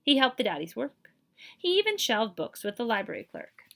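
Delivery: ordinary delivery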